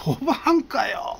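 comic astonished retort; incredulous cry. Retold or imitated. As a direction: senior stunned it's a woman. Senior reacts to the twist.

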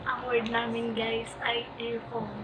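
hushed whisper to teammates. Real, not acted. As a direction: whisper it so the others can't hear. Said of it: "whisper lang talaga".

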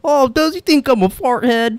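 high put-on mom voice; mom impression. Imitating Mom in Damien's story, high mock question. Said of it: "and Mom's like".

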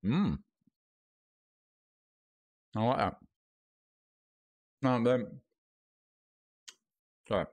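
talking through a mouthful of pear; words around a bite of food; mouth full. Real, not acted. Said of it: "I shouldn't chat with my mouth full".

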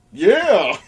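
loud, high "yeah" of triumph; re-enacted burst of delight; gleeful cheer. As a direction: let out a private victory whoop. His inward reaction to the threat.

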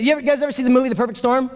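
usual speaking voice

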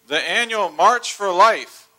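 usual speaking voice

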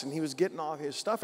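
ordinary talk

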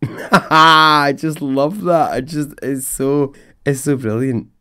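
high, loud, slow laugh into words; laughing delight. Real, not acted. Podcaster delighted by a listener's email, laughing.

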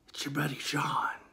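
soft eerie whisper; whispering caller. Retold or imitated. Caller whispering to the sleepy boy on phone. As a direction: hush it like a creepy friend.

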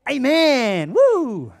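high, loud cheering amen; cheering wives. Retold or imitated. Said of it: "And all the wives go".

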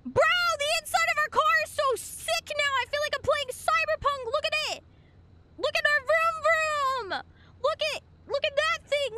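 high-pitched, breathless gushing at a new toy; really excited. Real, not acted. Streamer shows off the car's new inside, really excited. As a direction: gush very high, tumbling from one thing to the next. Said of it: "Sorry, I got really excited".

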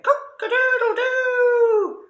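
very high loud crowing; rooster crow. A narrator's reading. Rooster wakes and scares the robber, high crow.

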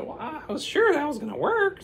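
very high falsetto; the witch, high and a bit disappointed. Acted. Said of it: "the witch said".

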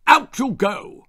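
father's loud, high command; barked order. A narrator's reading. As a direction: order the boy out angrily. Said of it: "he ordered".

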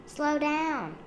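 high, loud urgent warning; shouting. A narrator's reading. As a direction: call after a runaway child. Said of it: "shouted Daddy".

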